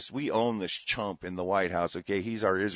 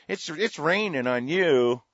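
sarcastic slow mock quote; 6 semitones higher, 3 dB louder, slower than usual.

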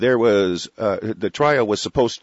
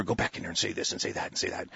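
softer, fast, hushed instructions; mock coaching murmur; 4 semitones lower, 12 dB quieter, faster than usual. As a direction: rattle off orders under your breath. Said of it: "coach 'em a little more".

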